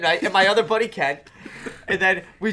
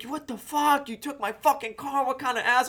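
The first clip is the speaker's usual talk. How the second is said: retold screaming; 6 semitones higher than usual. Son imitates mom screaming down the phone, mild mock yell. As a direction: imitate an outraged mother, pitched up. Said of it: "she starts screaming".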